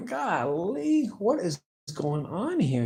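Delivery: high, drawn-out mock dismay; exasperated disbelief. Son reacting to mom blocking him, exasperated. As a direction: stretch it out high in retold bewilderment.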